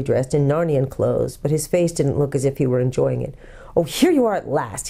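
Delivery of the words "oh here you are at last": put-on irritable boy, mildly cross; a touch of crossness. A narrator's reading. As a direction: grumble it at her. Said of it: "he said crossly".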